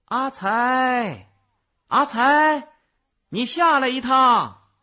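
高亢响亮地拖长声呼唤; 朝远处银幕上的人放声喊; 大声喊叫. A narrator's reading. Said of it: "福天儿喊道". (扯开嗓子高声喊人)

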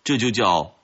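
usual speaking voice

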